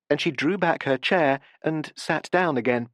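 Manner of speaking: ordinary delivery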